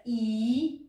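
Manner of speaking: E as a long, drawn-out ee; long sound. Shown as a demonstration. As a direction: hold the vowel in E slowly. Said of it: "Sunet lung și pronunț". (E cu sunet lung, prelungit)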